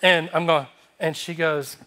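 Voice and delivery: plain reading voice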